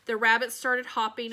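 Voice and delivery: plain reading voice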